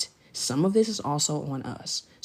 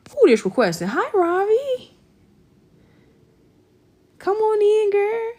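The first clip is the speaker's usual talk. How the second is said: high, loud, drawn-out welcome; excited welcome; 6 dB louder than usual. Real, not acted. Welcoming Ravi onto the stream, high excited call. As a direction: sing out a welcome to a friend.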